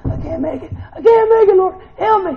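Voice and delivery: high, loud cry for help with a laugh breaking in; desperate plea. Acted. Broken believer crying out to the Lord, loud and desperate. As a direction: cry out to the Lord like you're at the end.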